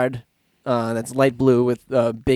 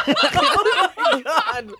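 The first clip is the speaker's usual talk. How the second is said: laughing; much slower than usual. Pretend. Laughing mimicry of friend.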